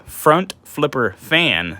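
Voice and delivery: slow, deliberate word by word; careful enunciation. Real, not acted. Reviewer retrying a tongue twister for viewers, careful and slow. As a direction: spell it out slowly after a tongue slip. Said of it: "Say that three times fast".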